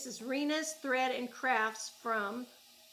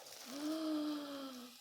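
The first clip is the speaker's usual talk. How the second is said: delighted gasp; 4 semitones higher, 4 dB quieter than usual. Real, not acted. Unboxing the exact yarn wanted.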